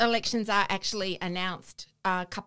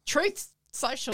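mocking name; 5 semitones higher, slower than usual.

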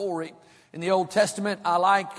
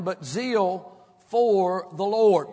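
"zeal for the Lord" stretched very slowly; slow emphasis; much slower than usual. Shown as a demonstration. Preacher driving home his theme, very slow. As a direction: linger over "zeal for the Lord", word by word. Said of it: "preacher stressing the key phrase".

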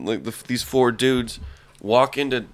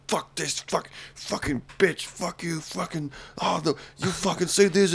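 put-on drunk cursing; 6 semitones higher, 6 dB quieter than usual. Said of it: "you were just swearing and cursing".

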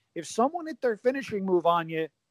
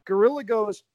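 usual speaking voice

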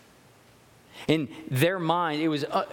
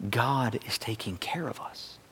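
hushed whisper of wonder; relieved Israelites whisper, awed, as water gushes out; 4 semitones lower, 5 dB quieter than usual. Acted. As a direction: breathe it out in amazement.

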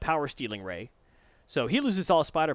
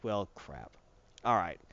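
softer, lower resigned grumble; 7 semitones lower, 5 dB quieter, slower than usual. Retold or imitated. Normie resigning himself, quiet and low. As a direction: give in quietly. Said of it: "He's kinda like".

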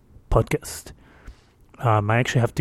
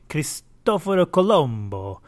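put-on Italian accent on the name; Italian-style pronunciation of the name; 5 semitones higher than usual. Shown as a demonstration. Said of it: "That's my best, um, Italian accent".

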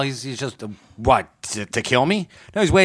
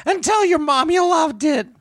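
very high mock hawker's call. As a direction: call the kids over in a hammed-up, piercing voice.

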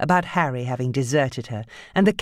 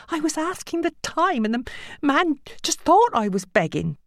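much higher, anxious explaining; frightened woman; 12 semitones higher than usual. A narrator's reading. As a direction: explain fast, afraid of him. Judy voiced higher, frightened.

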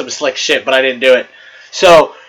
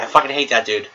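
normal talking voice

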